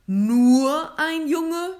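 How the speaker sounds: very loud, very slow, drawn-out question; huge booming Santa incredulity; thunderous repeat. A narrator's reading. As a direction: thunder it to the whole crowd, stretching NUR. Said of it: "wiederholte er".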